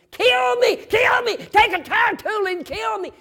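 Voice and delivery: desperate screaming plea, very high and loud. Retold or imitated. Trucker trapped in burning cab, screaming to be killed. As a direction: scream it like a man trapped in fire. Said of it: "that man started screaming".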